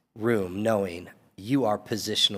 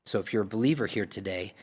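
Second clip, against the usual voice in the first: normal talking voice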